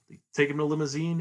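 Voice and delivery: ordinary talk, nothing marked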